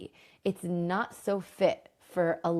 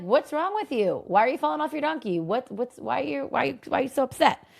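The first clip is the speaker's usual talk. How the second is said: voicing Kalev; 6 semitones higher than usual. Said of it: "and Kalev's like".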